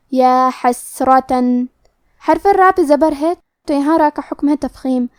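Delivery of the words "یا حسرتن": یا حسرتن slow, a breathy س and a heavy ر; hams and heavy ra. Shown as a demonstration. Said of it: "ہم یہ حرف کو ایسے پڑھیں گے". (حسرتن میں سین ہمس اور را موٹی آواز میں)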